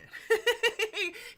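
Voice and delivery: loud, high burst of laughter; proud of her friend, laughing aloud; delighted laugh. Real, not acted. Laughs after "That's my friend.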